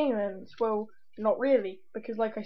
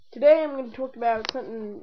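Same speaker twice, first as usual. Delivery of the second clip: ordinary talk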